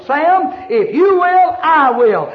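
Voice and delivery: God calling Sam, high and loud. Acted. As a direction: call out Sam like God making a promise. God speaking to the preacher.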